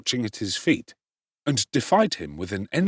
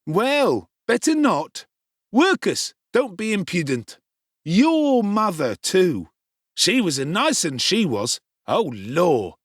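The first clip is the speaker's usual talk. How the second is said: put-on higher, sneering charity-boy voice; bully Noah; 8 semitones higher, 4 dB louder, slower than usual. Noah taunts Oliver about his mother, sneering. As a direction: taunt him with fake sympathy.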